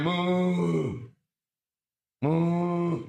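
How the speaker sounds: long, drawn-out moo, held on one note; imitation cow moo. Tied-up cow calls with baby near, long imitated moo. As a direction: moo slowly like a tethered cow. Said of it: "I'm a cow".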